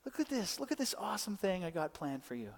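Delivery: soft, high, gentle coaxing; so sweet. Acted. God coaxing us toward his plan, sweetly. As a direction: coax a buddy softly and sweetly. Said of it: "like, so sweet and he's like".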